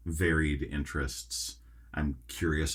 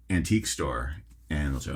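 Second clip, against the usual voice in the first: ordinary talk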